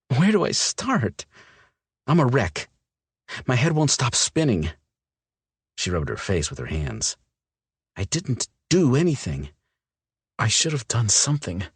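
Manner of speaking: a woman coming apart; distraught young woman. Acted. Olivia's figure voice, distraught.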